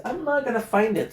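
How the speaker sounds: high exasperated cry; frustrated. Real, not acted. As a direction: whine it in frustration.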